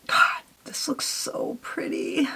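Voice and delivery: slow, hushed admiration; awed whisper. Real, not acted. Vlogger admiring her purple eye look, awed whisper. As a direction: whisper it slowly, taken with the look. Admiring the eyeshadow while applying it.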